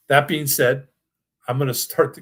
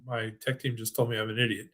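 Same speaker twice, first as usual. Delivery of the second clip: ordinary talk, nothing marked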